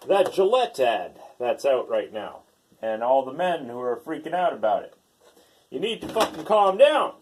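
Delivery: ordinary talk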